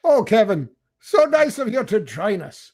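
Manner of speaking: put-on Irish lilt with a Yiddish twist. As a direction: greet him in a mock Irish-Yiddish accent.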